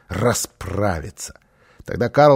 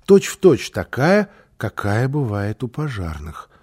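usual speaking voice

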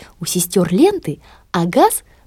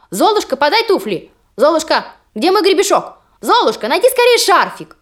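high, whiny demanding sisters; bossy sisters; 7 semitones higher than usual. Acted. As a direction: demand it impatiently, pitched up. Sisters demanding one after another.

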